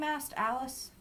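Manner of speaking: ordinary delivery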